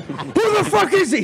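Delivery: very high-pitched imitated rage; mock screaming. Furious man to prank caller, high mock scream. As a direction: imitate a man losing his temper. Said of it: "He starts getting, like, really mad, screaming at me".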